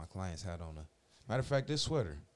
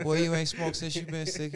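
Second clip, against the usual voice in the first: loud, high call-out to a stoned friend; incredulous yelling at his buddy; mock shout; 8 semitones higher, 10 dB louder than usual. Quoting himself to high friend.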